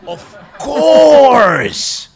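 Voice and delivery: exuberant shout. Real, not acted. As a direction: crow over the win with a big laugh. Boasting, laughing exuberantly.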